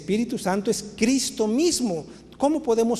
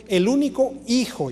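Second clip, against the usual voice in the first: único lifted higher and leaned on; stress on único; 4 semitones higher than usual. Shown as a demonstration. Preacher reading the creed to the congregation, "único" stressed. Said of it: "y voy a enfatizar esa palabra".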